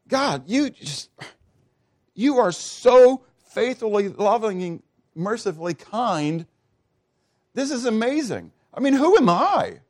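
high, fervent, breathless wonder; overwhelmed awe. Acted. Solomon overwhelmed, praising God, in high awed wonder. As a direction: pour it out in amazed gratitude.

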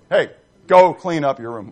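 put-on right way to tell a kid; firm parent command. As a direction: tell the kid straight, no question. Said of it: "So just say to him".

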